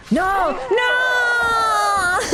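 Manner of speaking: anguished shout. Real, not acted. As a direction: cry it out in dismay. His duel is ruined.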